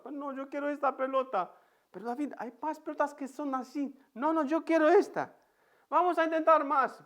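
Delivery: put-on a toddler refusing to give up; stubborn child. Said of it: "se ha puesto triste".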